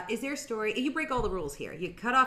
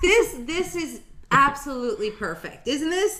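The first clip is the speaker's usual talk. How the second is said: excited; 8 semitones higher, 7 dB louder than usual. Real, not acted. Delighted over the photo.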